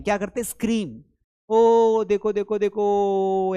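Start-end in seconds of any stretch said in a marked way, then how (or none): 1.5-3.5 a mock excited shout that fades into a slow देखो, देखो; excited kids screaming. Teacher imitates children spotting an aeroplane, mock excited. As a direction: call out like kids spotting a plane. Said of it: "बच्चे जोर से screaming करते हैं".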